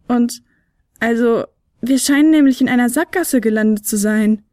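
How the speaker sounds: a small flute hedging, then admitting they're trapped; little flute Fionella, uneasy. Acted.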